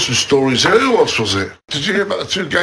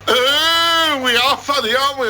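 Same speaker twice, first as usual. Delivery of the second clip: high, loud sing-song sarcasm; high loud sarcastic mock praise; 8 semitones higher, 3 dB louder, slower than usual. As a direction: tease him with an arch, high Oh.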